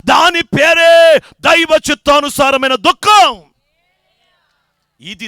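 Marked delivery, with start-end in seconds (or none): 0.1-3.4 slow, loud shouted proclamation; preaching at full force. Acted. Preacher names godly sorrow to the church in a slow shout. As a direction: hammer home each word slowly.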